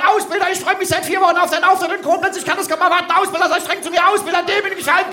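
high, breakneck pleading; loud gushing fan. Shown as a demonstration. Instructor showing an audience member what to shout, gushing and fast. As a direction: rattle it off high like an adoring recruit. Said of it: "rufst ganz laut".

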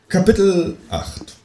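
high, drawn-out heading, falling at the end; chapter announcement. A narrator's reading. Reader announcing the next chapter to the audience, high and drawn out.

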